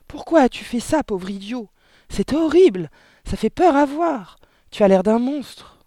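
high, loud, appalled wife; horrified shrieking. A narrator's reading. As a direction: hurl horrified reproaches at your husband. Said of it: "glapit la mère". (cris aigus, glapis et horrifiés)

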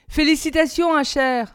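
called out. A narrator's reading. A classmate calls out congratulations to Asher, loud and bright. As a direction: toss it across the crowd cheerfully. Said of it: "lança quelqu'un".

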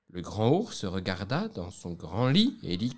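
plain reading voice

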